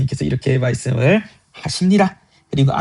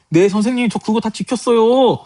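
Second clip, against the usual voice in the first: put-on eager young man; 8 semitones higher than usual. Rich young man answering his teacher, eager and high. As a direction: answer like a keen pupil, stretching the ending.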